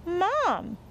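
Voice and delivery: put-on high-pitched little boy; child's voice. A narrator's reading. Boy tugging at his mother's arm, childlike. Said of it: "said Toby, tugging at his mother's arm".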